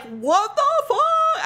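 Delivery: very high, slow, drawn-out disbelief; retold shock. Woman retelling learning of the wife, acted shock. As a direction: stretch it out high, acting stunned.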